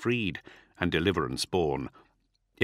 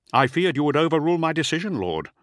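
higher, quick admission; defensive officer; 8 semitones higher, 4 dB louder than usual. A narrator's reading. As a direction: justify yourself quickly, pitched up. Bran defends himself to his lord.

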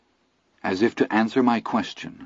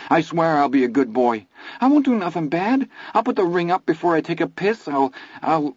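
much higher, panicky praying; terrified prayer; 8 semitones higher than usual. A narrator's reading. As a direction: pray in frantic fear. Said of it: "I was listening to a prayer".

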